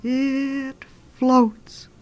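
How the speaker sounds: high, drawn-out eerie moan; haunting high wail, held long; ghostly voice. Acted. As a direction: stretch it out like a distant ghost. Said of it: "he heard a voice saying".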